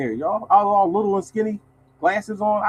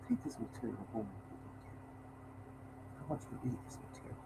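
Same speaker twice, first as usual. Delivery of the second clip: Dracula voice; 7 semitones lower, 26 dB quieter than usual. Retold or imitated. Vice president greeting the mailman, in a hushed Dracula voice. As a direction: lean in and purr it like a count. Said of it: "He talked like Dracula".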